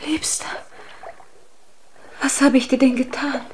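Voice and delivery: desperate plea. Acted. Undine desperately pleads with Huldbrand. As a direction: beg him, heartbroken. Wife pleads against rejection.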